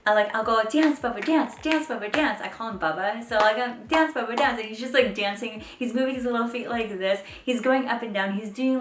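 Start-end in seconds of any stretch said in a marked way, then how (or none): 0.6-2.4 put-on high, loud playful chant to a toddler; cheering on. Mom cheering on dancing toddler. 3.9-4.6 put-on high, loud playful chant to a toddler; cheering on. Mom cheering on dancing toddler.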